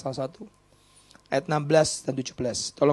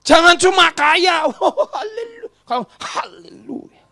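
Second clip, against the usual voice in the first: mocking hallelujah; 12 semitones higher, 11 dB louder, slower than usual. Mocking shallow praise.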